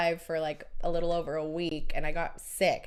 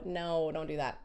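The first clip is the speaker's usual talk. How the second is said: ordinary delivery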